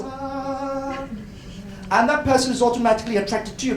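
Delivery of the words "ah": a sung, soaring ah. Retold or imitated. A lecturer voicing students' brain waves, a soft sung ah. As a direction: sing out the brain spike. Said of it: "the brain waves goes".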